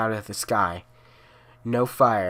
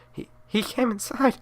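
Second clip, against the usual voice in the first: terrified; 11 semitones higher than usual. Acted. Terrified mother whispers.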